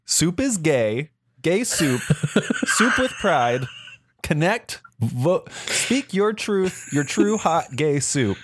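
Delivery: high, slow cheesy brand slogans. Retold or imitated. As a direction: pitch it like a corny ad. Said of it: "to be like".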